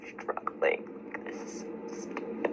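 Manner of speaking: breaking into a whisper through tears; choked, barely voiced frustration; tearful whisper. Real, not acted. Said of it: "why are you crying".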